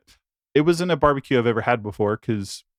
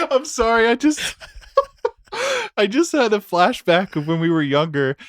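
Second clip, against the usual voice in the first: laughing; 11 semitones higher than usual. Real, not acted. Laughing at a flashback.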